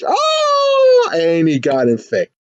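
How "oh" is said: very high mock battle cry; attack shout. Acting out the monster's sudden attack, shouting. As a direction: let out a high, drawn shout.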